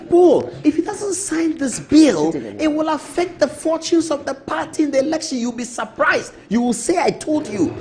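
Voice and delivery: hammed-up pushy colleague urging; high pitched mock nagging about the bill; imitating Brother Fo. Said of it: "He said".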